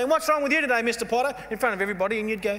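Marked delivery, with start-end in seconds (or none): 0.0-1.4 much higher, louder nurse calling out. Retold or imitated. Nurse calling the patient out in front of everybody, loud mock voice. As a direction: bellow it across the waiting room.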